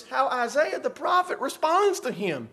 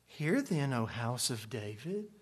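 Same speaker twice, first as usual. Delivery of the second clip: subdued, low scripture recital; churchy reading; 10 semitones lower, 15 dB quieter than usual. Shown as a demonstration. Preacher mimicking church reading of Isaiah, low and soft. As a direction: quietly intone it like a polite church reading. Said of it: "That's the way we read it".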